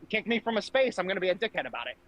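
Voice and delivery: usual speaking voice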